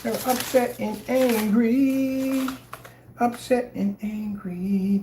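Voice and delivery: slow, high mock whine of upset and angry. Streamer slowly mocking angry chat in a high whine.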